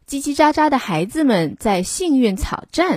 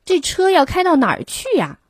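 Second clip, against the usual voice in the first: curious sailors; 8 semitones higher, faster than usual. A narrator's reading. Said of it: "轮渡上的一群船员们问道".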